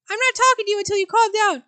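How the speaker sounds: put-on high, loud old mother refusing to talk; huffy old mother. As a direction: shut him out, offended.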